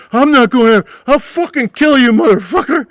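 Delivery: high, loud screaming threat; drunken threat. Retold or imitated. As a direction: scream it like a drunk ready to fight.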